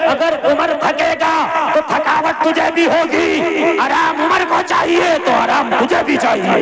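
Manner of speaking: high, loud sermon cry of equality; fiery preaching. Acted. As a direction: proclaim it to the whole crowd at full force. Preaching at full force.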